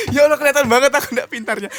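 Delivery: laughing. Real, not acted. Embarrassed after wrong answer.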